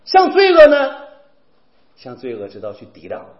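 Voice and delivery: rising, fervent sermon cadence; preaching at full force. Acted. Preacher urging the congregation on the Beatitudes, loud and fervent.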